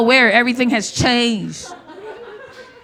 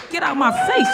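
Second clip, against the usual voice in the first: mock outraged yell. Comic mocking a rent flex, high fake yell.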